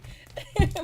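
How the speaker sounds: loud, high burst of laughter; amused laugh. Real, not acted. Reviewer laughing after imitating the couple's quarrel, amused laugh.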